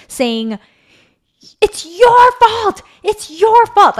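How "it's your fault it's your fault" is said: high-pitched full-voiced retold yell, drawn out; yelled accusation. Daughter blames mom in front of the neighbors, yelling. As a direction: imitate a child yelling blame, high and loud. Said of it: "she starts yelling at me".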